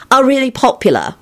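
high, self-satisfied finish; smug. A narrator's reading. Elizabeth completing Lupin's sentence, smug high voice. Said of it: "Elizabeth finished smugly".

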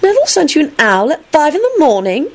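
high, incredulous question; incredulous, voice shooting up; disbelief. A narrator's reading. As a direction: ask as if you can't believe it. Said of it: "Rosie said in disbelief".

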